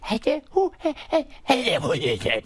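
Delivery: put-on a silly high-pitched man-child asking questions; high Sandler voice. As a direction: jump way up into a goofy high voice. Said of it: "then he does the thing where it's like".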